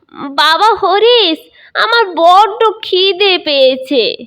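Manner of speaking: put-on high, slow plaintive old man; whining old grandfather. Old grandfather whining to his son, high and slow. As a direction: drag out the complaint like a hungry old man.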